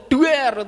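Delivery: sudden high "duer" bang; explosion sound. Retold or imitated. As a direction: mimic a grenade going off. Imitates a grenade going off. (suara ledakan granat)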